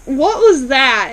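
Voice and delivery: loud, drawn-out disbelief; baffled exclamation. Retold or imitated. Viewer reacting to an odd phone call, loudly baffled. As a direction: burst out slowly in mock bewilderment.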